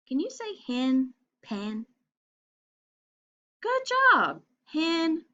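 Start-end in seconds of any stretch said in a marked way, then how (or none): 3.6-4.4 high, bright cheer; big praise. Real, not acted. Teacher praising children, high and excited. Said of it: "praising the children".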